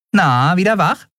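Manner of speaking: put-on bright, high girl calling out; cheerful young girl greeting. A narrator's reading. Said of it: "Orins fröhliche Stimme".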